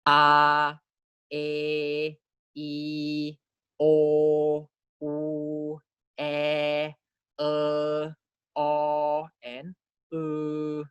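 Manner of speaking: each vowel held long on a level pitch; monophthong demonstratie. Shown as a demonstration. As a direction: recite A, E, I as separate sustained pure vowels. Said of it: "read the monophthongs for you".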